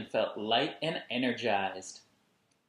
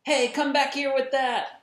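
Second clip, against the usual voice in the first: loud, much higher shout; yelling after her; 11 dB louder than usual. Acted. Raymond chasing his sister, shouting after her. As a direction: call after her in alarm.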